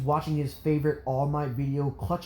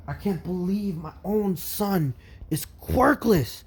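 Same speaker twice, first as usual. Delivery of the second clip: louder bursts of angry disbelief, pitched up; distraught father; 5 semitones higher, 3 dB louder, slower than usual. Acted. Father learns his son is quirkless, distraught outburst. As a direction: protest it in rising dismay.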